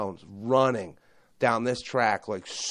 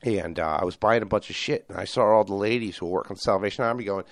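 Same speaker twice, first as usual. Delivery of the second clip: ordinary delivery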